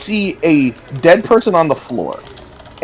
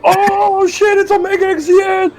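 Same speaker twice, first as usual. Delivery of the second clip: put-on excited kids. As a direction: erupt like fans spotting their idol. Kids erupt seeing him.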